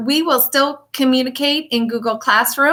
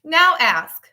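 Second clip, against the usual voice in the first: lively, sing-song picture book reading; reading to children. Acted. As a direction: read it like storytime for little kids. Teacher reads picture book aloud.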